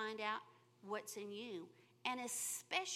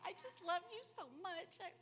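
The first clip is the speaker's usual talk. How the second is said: gushing love; 6 semitones higher than usual. Retold or imitated. Grateful to someone who picked up lint, tender and gushing. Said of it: "It's like".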